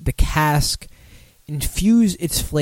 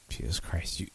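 breathy whisper of disbelief; whispered exasperation; 10 semitones lower, 18 dB quieter than usual. Real, not acted.

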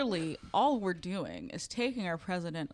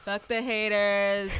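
mock Trump tweet; 4 dB louder, slower than usual.

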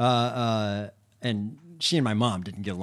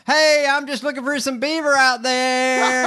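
high hammed-up trucker; loud high mock trucker on CB; 13 semitones higher, 7 dB louder than usual. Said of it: "it was like".

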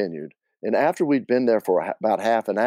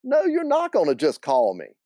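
higher, annoyed Southern woman; indignant, pitched-up protest; annoyed aunt; 8 semitones higher than usual. Acted. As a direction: push back in exasperation. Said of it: "started to get really annoyed with this guy".

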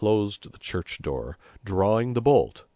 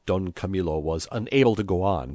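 ordinary delivery

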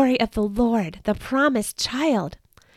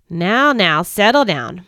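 louder, firm calming of a crowd; firm settling; 6 dB louder, slower than usual. Acted. Old woman quieting the shepherds, louder and firm. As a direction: settle the rowdy group.

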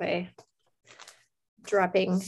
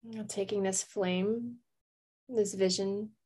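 calm meditation voice with long pauses; guided meditation; 4 dB quieter than usual. Real, not acted. Host leads listeners to a candle flame, calm meditation. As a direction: guide them gently, leaving space to breathe.

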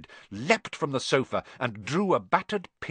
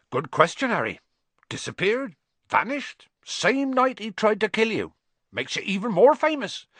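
high, louder eager telling; excited; 11 semitones higher, 4 dB louder than usual. A narrator's reading. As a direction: relish the mystery. Hagrid excited telling mystery.

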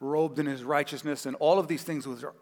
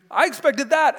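indignant outburst; 9 semitones higher, 6 dB louder than usual. Retold or imitated. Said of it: "he's so furious".

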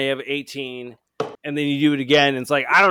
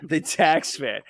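usual speaking voice

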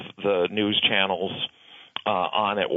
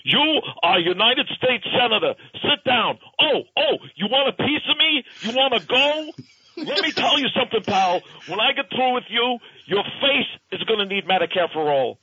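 wrestling promo; 12 semitones higher than usual. Retold or imitated. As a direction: bark it out like a ring heel. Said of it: "And he's like".

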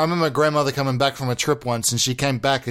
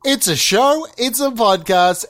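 slow, drawn-out podcast opener; hammed-up welcome to Big Tall Boys; show host intro; 4 semitones higher, 4 dB louder, slower than usual.

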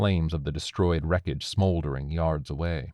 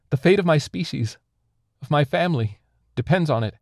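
high, raised alien voice; impassioned pleading; 10 semitones higher, 6 dB louder than usual. A narrator's reading. Alien begs captor for its family's sake, impassioned pleading.

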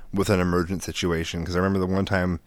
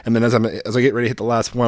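ordinary talk

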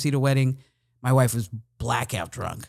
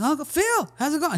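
high, excited MC shout-out; hyped-up greeting. Retold or imitated. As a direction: greet the DJ like a hype man. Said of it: "I was like".

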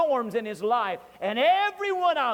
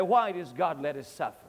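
ordinary talk, nothing marked

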